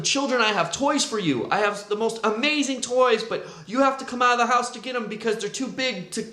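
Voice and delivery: luring yell. Retold or imitated. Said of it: "So he yells to them".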